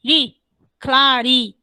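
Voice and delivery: "ri" set apart, then "claridade" broken into slow syllables; "claridade" spelled out beat by beat to show its stress; tonic syllable demo. Shown as a demonstration. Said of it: "o som tônico forte é em ri".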